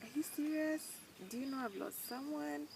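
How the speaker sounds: high, incredulous "are you serious"; disbelief. Retold or imitated. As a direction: act stunned that anyone would say it. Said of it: "people say things and you're like".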